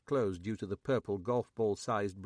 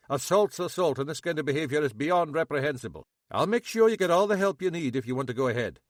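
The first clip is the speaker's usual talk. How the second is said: indignant; 5 semitones higher, 8 dB louder, faster than usual. Acted. Munro indignant.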